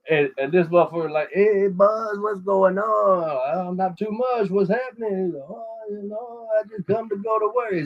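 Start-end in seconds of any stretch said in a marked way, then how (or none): 1.3-5.2 lightly higher, mock Mexican painter greeting in broken English; a casual, lightly put-on Mexican accent; broken English imitation. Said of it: "he's speaking broken English". 5.5-7.8 higher, offhand mock Mexican accent; broken English imitation. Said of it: "he's speaking broken English".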